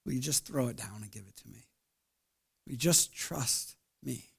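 soft, hushed invitation; quiet tender plea; gentle voice of God. Retold or imitated. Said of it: "hear God saying".

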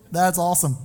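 ordinary talk, nothing marked

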